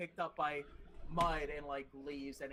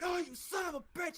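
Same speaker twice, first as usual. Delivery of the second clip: high-pitched mock angry curse; retold cursing; 11 semitones higher than usual. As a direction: imitate an attacker cursing, pitched up. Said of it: "cursing at each other".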